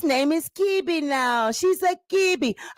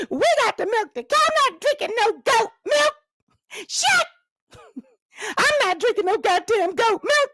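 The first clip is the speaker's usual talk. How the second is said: higher, fast indignant complaining; outraged wife; 7 semitones higher than usual. Acted. Tasha refusing goat milk, high indignant rant.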